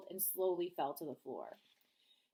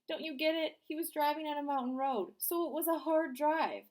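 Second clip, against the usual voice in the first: high, louder pleading explanation; insistent joker; 8 semitones higher, 5 dB louder than usual. Acted. Danny insists to Beth, high and eager. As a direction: make them get the joke. Danny pushing his joke.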